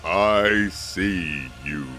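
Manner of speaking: slow otherworldly emperor voice; slow ghostly whisper. Acted. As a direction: draw out each word like a statue speaking.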